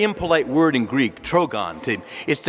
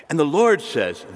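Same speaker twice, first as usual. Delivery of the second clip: ordinary delivery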